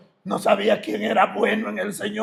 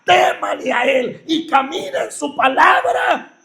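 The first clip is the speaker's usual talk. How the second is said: loud, high preaching at full force; impassioned pulpit sermon voice; fiery preaching; 5 semitones higher, 6 dB louder than usual. Acted. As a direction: preach it out, pounding the congregation.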